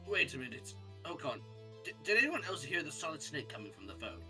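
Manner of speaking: ordinary delivery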